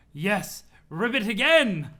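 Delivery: loud, high, thrilled demand; delighted cry. Acted. Snob thrilled by the frog girl, loud delighted cry. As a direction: cheer for another ribbit.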